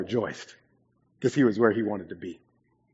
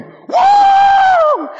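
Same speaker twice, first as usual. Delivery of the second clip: very high loud whoa; imitating Andy's whoa; 15 dB louder than usual. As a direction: belt it like an overjoyed friend. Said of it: "he'd just walk in".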